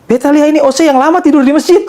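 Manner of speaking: put-on high Ambon accent. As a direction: play the amused local in Ambonese. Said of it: "Pakai logat Ambon kan".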